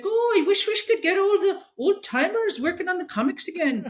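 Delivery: high mocking Scottish accent, laughing through it; Mark Millar Scottish accent. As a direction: send up a fellow Scot in a broad Scottish accent, laughing. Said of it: "so I'm gonna do the Scottish accent".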